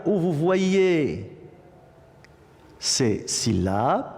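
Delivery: usual speaking voice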